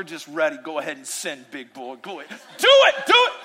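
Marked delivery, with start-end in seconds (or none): 0.6-2.4 low, much softer menacing taunt; hushed mock threat of an angry God. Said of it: "portray God ... with the Louisville Slugger". 2.6-3.3 much higher, louder laughing yell; hammed-up shout from an angry God; mock shouted taunt. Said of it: "portray God ... with the Louisville Slugger".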